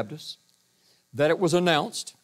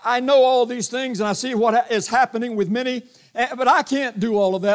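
higher and louder, earnest protest to the Lord; pleading prayer; 6 semitones higher, 5 dB louder than usual. Retold or imitated. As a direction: raise your voice in helpless protest. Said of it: "you say".

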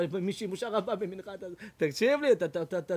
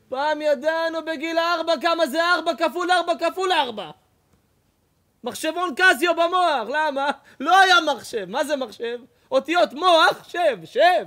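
high, loud, driving sermon voice; full-force rabbi's exhortation; fiery preaching; 9 semitones higher, 9 dB louder than usual. Acted. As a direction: preach it hard, almost shouting.

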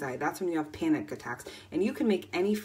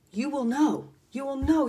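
higher and louder, hammering it home; fired-up declaration; 8 semitones higher, 5 dB louder than usual. Real, not acted. Coach preaching to viewers, fired-up and loud. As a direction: insist on it with rising fervour.

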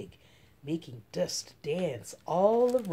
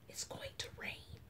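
breathy secret whisper; 15 dB quieter than usual. A narrator's reading. Girl whispering to her friend at the door. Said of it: "I whisper".

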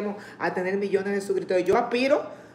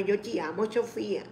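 tender, softer; 6 dB quieter than usual. Real, not acted. Greeting a child viewer.

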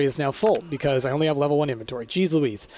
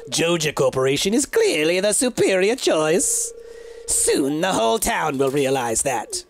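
super villain voice. Acted. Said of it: "I like giving him a super villain voice".

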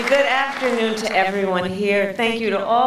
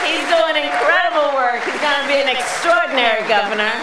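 raised, higher projecting rally voice; rally speech; 8 semitones higher, 6 dB louder than usual. Acted. Vice president thanking local leaders at rally, projecting speech. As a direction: address the crowd like a stump speech.

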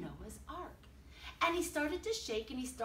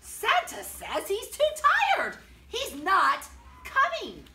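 announcing the shocking news; screaming headline; 9 semitones higher, 9 dB louder than usual. A narrator's reading. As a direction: shout it like a newsboy. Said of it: "Headlines screamed".

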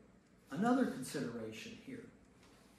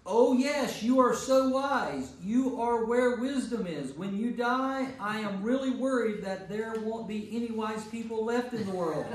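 mocking sarcasm; 7 semitones higher, 7 dB louder than usual. Job mocking his friends with high, loud sarcasm. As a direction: lay on the fake praise thick. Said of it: "So little, little sarcasm".

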